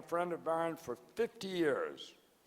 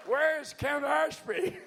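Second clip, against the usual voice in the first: loud, high, slow call into the crowd with a laugh; 9 semitones higher, 4 dB louder, slower than usual. Real, not acted. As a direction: shout his name across the hall, laughing. Calling out to audience member.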